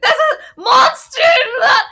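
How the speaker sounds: high, loud, slow panicked shout; imitating a terrified man yelling for help; panicked cry. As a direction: cry out in terror with a laugh breaking through. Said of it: "He was like".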